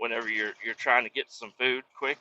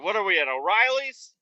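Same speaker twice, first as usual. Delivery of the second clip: higher, lightly voiced nosy clerk; mocking clerk; 6 semitones higher than usual. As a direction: mimic the pointless questions. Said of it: "It's like".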